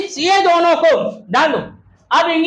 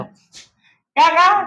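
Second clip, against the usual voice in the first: drawn-out prompt; much slower than usual. Shown as a demonstration. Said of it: "ध्यान दो।".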